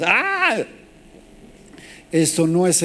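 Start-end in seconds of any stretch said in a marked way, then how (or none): 0.0-0.7 high imitated cry of wounded sheep; sheep's mock scream. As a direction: yelp like a hurt sheep, hammed-up. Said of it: "las ovejas". (grito agudo fingido de oveja herida)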